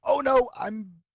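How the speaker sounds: a hammed-up frightened god; panicked Janus. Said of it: "He's like".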